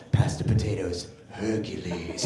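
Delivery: very deep superhero voice; little boy as Hercules. Retold or imitated. Son at dinner staying Hercules, very deep. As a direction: boom it from the bottom of your range. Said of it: "He's all".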